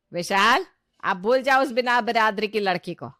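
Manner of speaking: loud, commanding call to a son; lightly voiced mother laying down the law; insistent mother. Mother tells son to forget the other girl, insistent.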